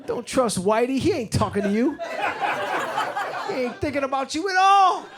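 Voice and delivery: a lightly voiced old jazz musician handing out advice; old jazzman. Drunk on Hennessy, preaching life advice to strangers, lightly voiced old jazzman. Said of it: "I was like".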